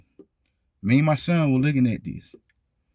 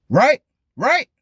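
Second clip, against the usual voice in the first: emphatic right; 12 semitones higher, 7 dB louder than usual. Acted. Pressing viewers for agreement.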